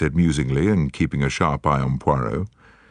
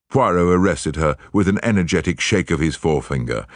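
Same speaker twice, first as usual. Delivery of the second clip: ordinary delivery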